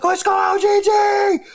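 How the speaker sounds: high, drawn-out fan shout; full-out yelling. Retold or imitated. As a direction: yell for your team across a packed arena. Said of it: "yelling as loud as I could".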